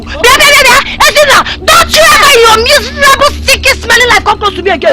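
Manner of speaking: high crying yell; furious and crying at once; tearful shouting. Acted. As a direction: scream through tears.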